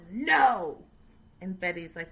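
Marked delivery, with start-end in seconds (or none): 0.1-0.8 higher, louder mock refusal; imitated angry no. Father shutting down the son's compromise, mock shouted no. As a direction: snap out a mock no like a stubborn dad. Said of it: "And Ron's like".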